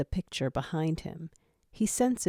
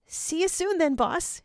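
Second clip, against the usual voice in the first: kind and reassuring; 12 semitones higher than usual. A narrator's reading. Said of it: "his voice kind and reassuring".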